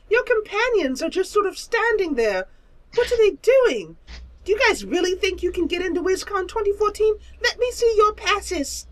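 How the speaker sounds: lofty squeaky creature; prissy high monster drawling. Acted. Creature demands passes.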